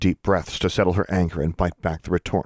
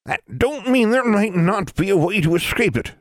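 put-on old granny voice; 4 dB louder than usual. A narrator's reading. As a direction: speak like an old mountain granny. Granny Ford speaks.

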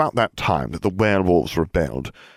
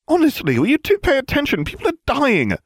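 very high, louder exasperated scolding; shrieky, pitched-up girl's reprimand; snapped; 4 dB louder than usual. A narrator's reading. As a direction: snap at two friends who aren't listening. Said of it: "Hermione snapped".

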